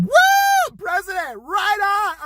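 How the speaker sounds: high, loud whooping cheer; partying kid whoop. Retold or imitated. Young president cheering his win, loud whoop. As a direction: whoop it up like a drunk young winner. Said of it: "some twenty-one-year-old going".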